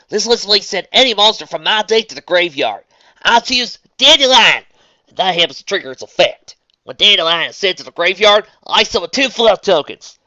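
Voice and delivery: put-on country girl calling her move; Southern accent. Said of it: "doing a Southern voice for a duel".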